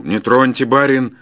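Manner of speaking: forceful forester's cry; shouted warning. A narrator's reading. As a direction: shout at the gentleman to keep off. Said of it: "крикнул на меня лесник".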